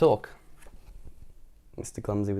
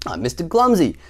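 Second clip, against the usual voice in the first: higher, louder character introduction; cheery clumsy cousin; 4 semitones higher, 6 dB louder than usual. A narrator's reading. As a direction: announce yourself with a grin. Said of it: "replied the untidy person, grinning".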